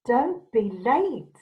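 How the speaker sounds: ordinary delivery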